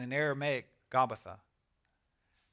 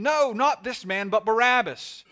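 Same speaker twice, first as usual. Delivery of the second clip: crowd crying out; 7 semitones higher, 11 dB louder than usual. A narrator's reading. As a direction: cry out in protest as the crowd. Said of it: "they cried out again".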